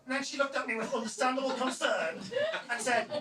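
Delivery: normal talking voice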